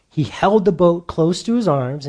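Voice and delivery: ordinary talk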